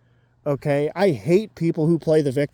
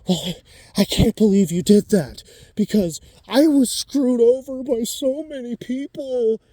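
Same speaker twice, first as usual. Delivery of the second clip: sarcastic victim voice, pitched up; mock whiny victim; 8 semitones higher than usual. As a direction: whine like a martyr crying foul. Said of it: "they're like".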